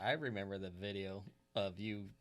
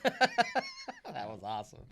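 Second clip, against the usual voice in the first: laughing; 13 semitones higher, 7 dB louder, much slower than usual. Real, not acted. Laughing after the imitation.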